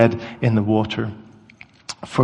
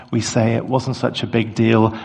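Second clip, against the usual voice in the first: usual speaking voice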